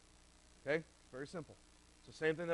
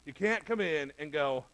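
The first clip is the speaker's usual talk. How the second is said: ordinary talk, nothing marked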